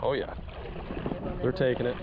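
ordinary talk, nothing marked

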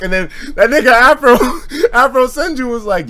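ordinary talk

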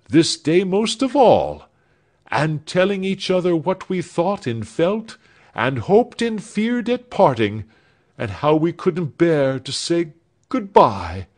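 Alfred voiced with hopeful fervour; earnest young man. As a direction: promise the future with feeling. Alfred continues his farewell.

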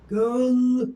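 put-on "Girl." loud, with a hard l; mock the loud wrong "Girl.". Shown as a demonstration. Teacher jokingly doing the wrong girl. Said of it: "Ih, değil ya".

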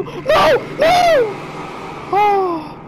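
screaming no over and over in real panic; panicked screaming. Real, not acted. Gamer chased by the game monster, terrified screaming.

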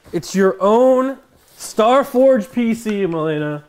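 grand presentation. Real, not acted.

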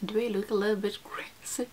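a breathy whisper, as if confiding; whispered aside. Real, not acted. Beauty vlogger whispering a self-mocking aside to the camera. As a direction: let it slip out in a hushed whisper.

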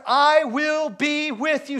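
high, loud and very slow, word by word; leading the church in a promise; slow declaration. Shown as a demonstration. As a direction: proclaim it for the congregation to repeat. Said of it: "Say it with me".

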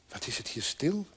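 very soft, low wonder; quiet, awed inner voice; hushed thought. A narrator's reading. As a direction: think it aloud almost inaudibly. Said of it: "dacht hij".

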